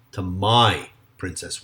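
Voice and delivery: slow down and hit "my" in "to my princess"; stress on my. Shown as a demonstration. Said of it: "with an emphasis on the my".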